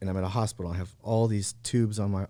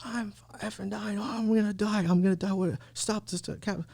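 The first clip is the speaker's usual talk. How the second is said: retold panicked scream; 11 semitones higher than usual. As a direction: replay the panic in a high strained voice. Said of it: "I start screaming on top of my lungs".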